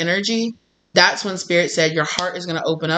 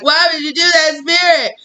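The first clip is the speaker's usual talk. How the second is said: bewildered cry; 10 semitones higher, 4 dB louder than usual. Retold or imitated. Falling, asks Spirit why.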